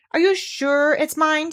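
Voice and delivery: high, slow squeaky question; excited squeak. A narrator's reading. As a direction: squeak it out, barely daring to hope. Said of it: "squeaked Piglet excitedly".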